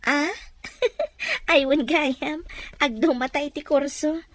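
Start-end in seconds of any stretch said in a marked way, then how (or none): 0.6-1.5 high giggle. Acted. Laughs off friend's remark.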